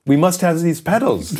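put-on high-pitched, pleading German accent; hammed-up German customer, pitched well up. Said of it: "Sorry about the German accent".

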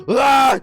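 loud, high mock battle cry; imitated charging yell. Imitating Ahmet charging in with a yell. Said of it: "diye koşarsa".

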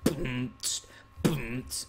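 soft beatboxed boom and hiss; beatbox hydraulic thumps by mouth; vocal bass drops. Pretend. Player fakes hydraulics in boots, beatboxing. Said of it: "epic hydraulics in my".

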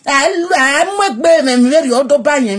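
loud escalating scolding; heated rant. Real, not acted. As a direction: tear into Yuri.

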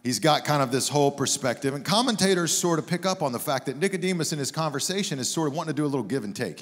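ordinary delivery

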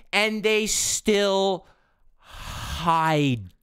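painfully drawn out in disbelief; exasperated drawl. Real, not acted. Gamer ranting about teammates who still hide, exasperated and drawn out. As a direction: stretch each word out, exasperated.

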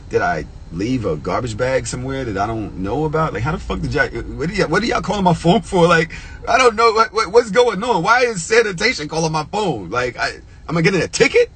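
rising into high, incredulous questioning; agitated bafflement. Retold or imitated. Puzzled man reacting to a sanitation call, agitated bafflement. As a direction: stammer through it, pitch climbing in disbelief.

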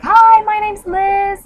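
put-on Liverpool accent. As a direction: sing out the name in a cheery Liverpool accent. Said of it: "and she's from Liverpool".

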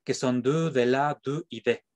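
"du", "de la", "du", "de" in French sounds; French partitive articles. Shown as a demonstration. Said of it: "artículos partitivos".

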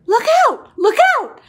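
high, loud alarm call; warning cry. Acted. Story animal calling out danger to the others. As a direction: shout a warning.

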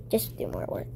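normal talking voice

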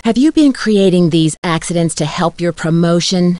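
ordinary delivery

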